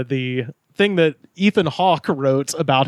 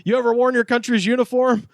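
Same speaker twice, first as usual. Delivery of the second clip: higher, hammed-up veteran's challenge; mock stern challenge; 8 semitones higher than usual. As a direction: read it like a soldier calling out a coward. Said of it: "Chris, a former Army Ranger and helicopter pilot, said".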